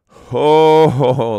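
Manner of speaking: loud, high "Ho, ho, ho"; mocking laughter. A narrator's reading. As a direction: laugh down at the captives. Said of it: "laughed the village folk".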